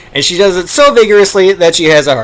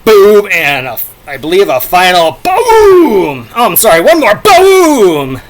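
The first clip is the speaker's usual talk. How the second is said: loud, slow, laughing boom; laughing dramatic boom; 5 semitones higher, 7 dB louder, slower than usual. Shown as a demonstration. Vlogger closing his sound-effect reading with a laughing boom. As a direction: stretch the final boom out, laughing. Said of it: "That was a very dramatic boom".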